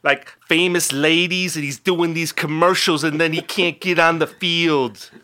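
hammed-up Wisconsin sports-talk caller; Herb from Pulaski. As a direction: sound off like an exasperated Wisconsin fan calling in. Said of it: "Herb from Pulaski".

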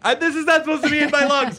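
high, loud panicked yelp; hammed-up alarm at a skunk smell; mock panic. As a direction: squeal it like you're sure the fumes will kill you. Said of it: "You're like".

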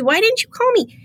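retold high-pitched appeal; imitated insistence. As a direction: mimic her pressing for an answer. Imitating mayor's repeated question.

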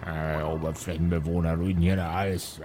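put-on low, soft muttering old dwarf; grumbling dwarf. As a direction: mutter curses under your breath. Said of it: "Er murmelt vor sich hin".

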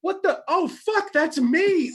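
very high-pitched mock astonishment; acted shocked realization. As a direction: play the moment it suddenly dawns on you. Acts out sudden self-recognition.

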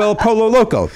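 "Pollo" as POH-lo, L sounded; anglicized pronunciation. Shown as a demonstration. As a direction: pronounce "Pollo" with an English L. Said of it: "has an L in it".

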